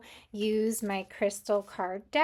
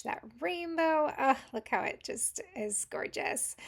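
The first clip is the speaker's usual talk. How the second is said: higher-pitched, awed admiration; admiring wonder; 5 semitones higher than usual. Real, not acted. Reader showing viewers the rainbow moonstone, admiring wonder. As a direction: coo over something gorgeous. Admiring the stone's blue flash.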